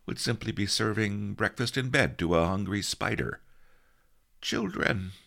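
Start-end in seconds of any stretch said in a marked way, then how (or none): 4.4-5.1 high-pitched dreamy mumble; sleep-talking old master; muttering in sleep. A narrator's reading. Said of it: "Master Li muttered".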